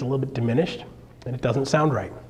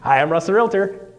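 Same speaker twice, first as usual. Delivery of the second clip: a touch brighter, louder intro; presenter retake; 5 semitones higher, 4 dB louder than usual. Shown as a demonstration. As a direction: restart the greeting with a lift. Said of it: "Off I go, right?".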